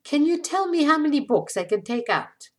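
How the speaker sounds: lightly voiced Eastern European woman; Eastern European accent. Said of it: "with a heavy Eastern European accent".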